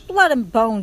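loud, eager crowd call; another rat's shout. A narrator's reading. Second rat joining in on the guessing, shouting. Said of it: "shouted another".

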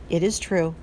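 ordinary delivery, nothing marked

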